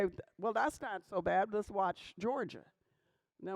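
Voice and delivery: ordinary talk, nothing marked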